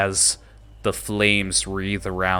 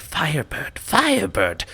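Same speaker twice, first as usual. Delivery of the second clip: goblin whispers; 8 semitones higher than usual. Acted. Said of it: "you see them whisper among each other".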